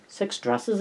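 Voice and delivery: high, softened disbelief; strangled restraint. A narrator's reading. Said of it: "strangling the urge to yell".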